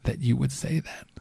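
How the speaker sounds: hushed, breathy scandalized whisper; mock gasp of disapproval. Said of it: "some other person said".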